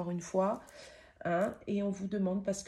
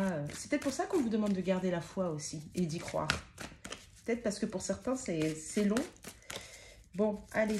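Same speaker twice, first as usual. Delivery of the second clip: ordinary talk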